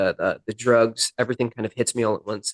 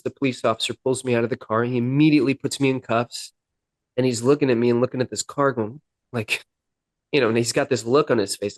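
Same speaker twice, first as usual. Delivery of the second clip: ordinary talk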